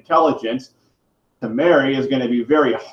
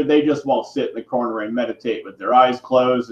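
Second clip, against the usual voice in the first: usual speaking voice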